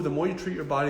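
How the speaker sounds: ordinary talk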